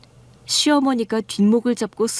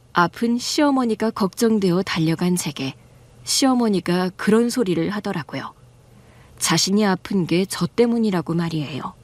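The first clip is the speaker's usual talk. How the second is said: ordinary delivery, nothing marked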